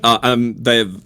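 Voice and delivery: usual speaking voice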